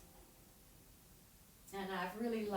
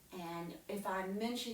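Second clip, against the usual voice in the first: plain reading voice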